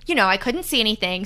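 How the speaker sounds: usual speaking voice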